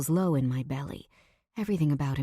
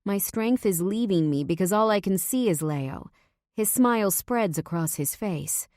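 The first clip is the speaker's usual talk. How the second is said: usual speaking voice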